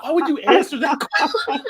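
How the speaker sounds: laughing, high-pitched retold reproach; mock exasperation, laughing. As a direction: play her disbelief while cracking up. Acting out woman's reaction while laughing.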